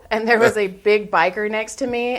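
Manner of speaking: ordinary talk, nothing marked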